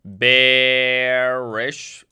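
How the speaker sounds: "bearish" stretched long with a rising end, as if typing it out; drawn out while typing. Real, not acted. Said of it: "Okay, I can't even type".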